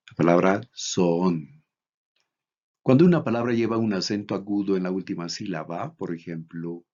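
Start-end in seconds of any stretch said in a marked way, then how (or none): 0.8-1.6 "zoon" said slowly, leaning on the long O; stress on accented syllable. Shown as a demonstration. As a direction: stretch the accented vowel of "zoon". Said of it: "el tono de la voz debe cargarse en la sílaba". ("zoon" con la o cargada y alargada)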